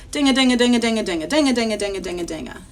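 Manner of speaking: fast, hammering ding-a chant; jackhammer. Acted. Teacher imitates backyard construction, fast jackhammer rattle. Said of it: "jackhammer making all that sound".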